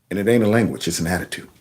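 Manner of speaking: low, soft mock-patriot tag; low punchline aside. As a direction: drop the voice for a confiding punchline.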